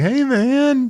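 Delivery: high, dazed stoner greeting; stoned dude. Retold or imitated. Kevin Bacon, drugged, greets his captors in a dazed, stoned voice. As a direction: float it out like he's baked. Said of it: "clearly high".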